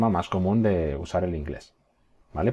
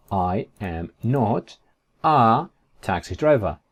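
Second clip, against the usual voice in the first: very slow, word by word; drawn-out, careful English; slow model sentence; much slower than usual. Shown as a demonstration. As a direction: pause after each word for learners. Teacher models negative sentence slowly.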